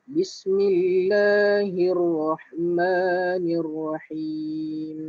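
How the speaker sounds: slow, drawn-out tajwid recitation; slow Quran recitation. Shown as a demonstration. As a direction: recite it slowly for students to follow. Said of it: "ayat pertama". (bacaan tajwid perlahan)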